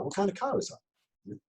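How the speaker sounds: high, slow curious question. Shown as a demonstration. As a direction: ask it with real curiosity. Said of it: "lazy Jedi questions".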